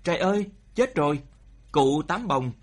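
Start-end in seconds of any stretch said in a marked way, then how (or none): 0.0-1.2 higher, quick cry of alarm; panicked scream. A narrator's reading. Villager crying out at the giant fish, panicked scream. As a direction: narrate a horrified outcry, pitched up and fast. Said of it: "một tiếng thét thất thanh la lên".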